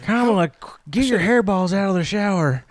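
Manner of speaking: high, nagging roommate voice; Biden as roommate. Acted. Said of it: "I like to imagine that Biden".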